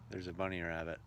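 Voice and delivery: ordinary delivery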